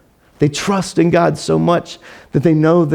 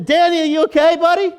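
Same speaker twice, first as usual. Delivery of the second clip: higher, louder call down into the den; a king calling anxiously down to Daniel; 11 semitones higher, 3 dB louder, faster than usual. Retold or imitated. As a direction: shout down to a friend in the pit. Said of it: "he yells down to Dan".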